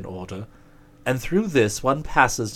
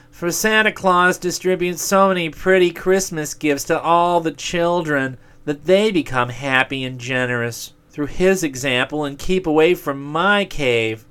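higher, louder grumbling demon; selfish demon voice; 6 semitones higher, 4 dB louder than usual. Acted. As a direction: complain as a sulky demon. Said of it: "said the demon of selfishness".

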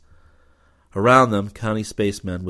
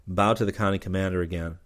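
usual speaking voice

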